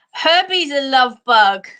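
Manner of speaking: ordinary talk, nothing marked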